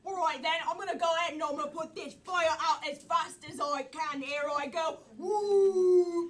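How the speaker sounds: brisk, lively Cockney; eager Cockney storytelling; Cockney accent. Acted. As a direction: put on a broad Cockney accent. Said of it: "because she heard [name] do Cockney".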